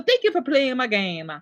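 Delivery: high mock-Italian accent; playful Italian video game hero voice; Mario. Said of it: "I love the Italian accent".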